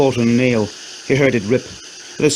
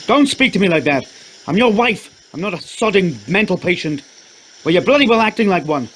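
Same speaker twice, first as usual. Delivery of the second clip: angry quarrel; 8 semitones higher than usual. Acted. Shouting quarrel between spouses.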